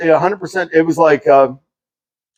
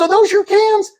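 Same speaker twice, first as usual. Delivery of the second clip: high, sweetly apologetic voice; nice drug dealer. Retold or imitated. Drug dealer apologizing to his neighbor, high and friendly. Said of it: "actually a nice drug dealer".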